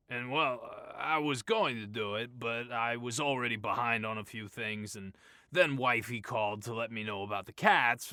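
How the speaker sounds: rambling boss. A narrator's reading.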